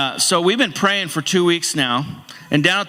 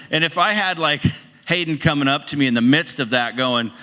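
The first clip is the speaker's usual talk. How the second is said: usual speaking voice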